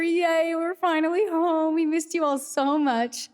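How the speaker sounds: high, excited welcome; gushing greeting. Real, not acted.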